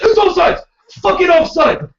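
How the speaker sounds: put-on high, loud German-accented screaming. Sideline dad screaming at the referee.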